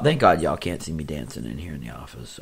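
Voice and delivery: normal talking voice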